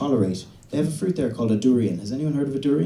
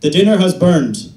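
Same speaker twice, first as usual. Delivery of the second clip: loud mock-formal report; deadpan announcement; 6 semitones higher, 10 dB louder, slower than usual. Husband telling his wife the dinner burned, loud and stiff. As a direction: announce the disaster with stiff calm. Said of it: "and then go".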